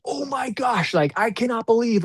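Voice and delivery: high, gushing amazement; thrilled. Retold or imitated. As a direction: burst with excitement at your own work. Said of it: "his confidence was over the top".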